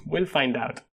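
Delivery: soft, quiet laugh through words. Real, not acted. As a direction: chuckle it out softly. Laughs after his own joke.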